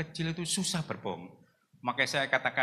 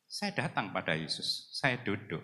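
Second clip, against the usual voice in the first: ordinary talk, nothing marked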